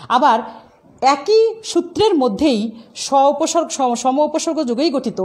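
ordinary delivery

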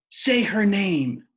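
high, loud, slow demand; screaming demand. A narrator's reading. Rob demanding his father say his mother's name. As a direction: scream each word as a demand. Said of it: "Rob screamed into the silence".

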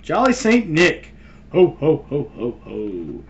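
high, loud jolly ho ho ho; jolly Santa. Pretend. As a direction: boom it out like Santa. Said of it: "I can be, uh".

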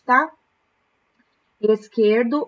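usual speaking voice

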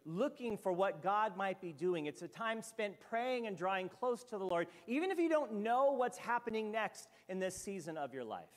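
ordinary delivery

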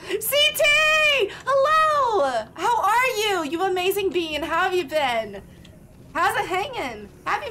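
high loud gushing hello to a viewer; high, loud, delighted welcome; excited greeting. Real, not acted. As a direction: greet a friend with a big happy shout.

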